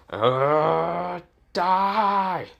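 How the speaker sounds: high, strained snarl; hammed-up furious Jedi; angry strike cry. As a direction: spit it out mid-swing in rage.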